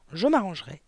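ordinary delivery, nothing marked

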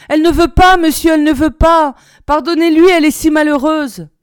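crying out. A narrator's reading. Old maid kneels begging the officer, desperate cry. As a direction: beg on your knees at full voice.

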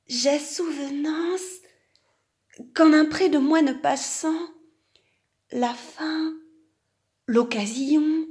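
high-pitched, naive donkey voice; confessing donkey. Acted. Donkey admitting he grazed the monks' meadow, naive confession.

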